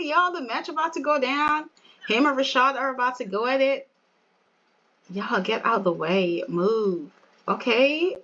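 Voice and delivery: ordinary talk, nothing marked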